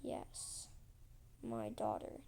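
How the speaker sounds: low, hushed, deliberate reply; ominous queen. Retold or imitated. Queen answering the man who stalls, ominous. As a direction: drop low and soft, as a veiled threat.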